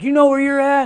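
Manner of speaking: high, loud confrontational question; imitated loud demand. Officer to inmate waking in intensive care, imitated loud demand. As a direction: imitate an officer yelling a challenge.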